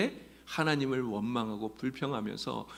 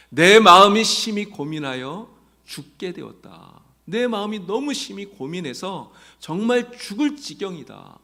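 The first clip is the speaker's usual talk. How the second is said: anguished lament; 6 semitones higher, 10 dB louder than usual. Retold or imitated. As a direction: pour out the anguish of a soul near death. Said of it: "이렇게 말씀하셨어요". (죽을 듯한 고뇌를 크고 느리게 토로)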